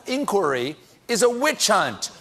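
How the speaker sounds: ordinary delivery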